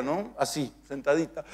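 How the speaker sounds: usual speaking voice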